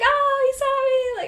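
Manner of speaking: high, loud and drawn out with delight; thrilled squeal. Retold or imitated. Hiker thrilled the crop duster pilot saw her, high delighted squeal. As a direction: squeal it like a kid who's been noticed.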